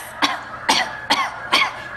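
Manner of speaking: a squeaky creature's cough; a sick little Lorax coughing; high raspy cough. Acted.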